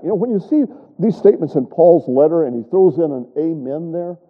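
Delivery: ordinary talk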